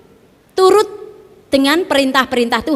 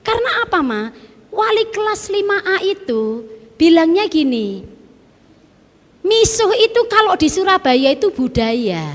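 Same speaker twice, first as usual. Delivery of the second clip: put-on higher voice of a young daughter; child's voice; 7 semitones higher than usual. Mother voicing her daughter, a bit higher. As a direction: speak up like a child reporting. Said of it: "anak saya ini bilang gini".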